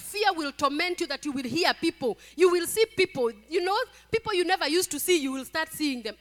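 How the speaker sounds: loud, high preacher's warning; full-force preaching. Real, not acted. Preacher warns a sister about fear, forceful preaching. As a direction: warn them with rising force.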